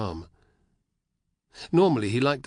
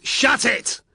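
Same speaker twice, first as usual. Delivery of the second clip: higher, louder bark to silence his comrade; barked order; 14 semitones higher, 8 dB louder than usual. Acted. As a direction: snap it out as a warning. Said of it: "barked the first legionary".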